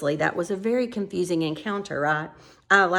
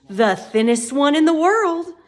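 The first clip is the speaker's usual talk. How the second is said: higher and louder, cheerful; bright; 8 semitones higher, 7 dB louder than usual. A narrator's reading. Fat man answers Tok brightly, higher and louder. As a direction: announce it brightly. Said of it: "he replied brightly".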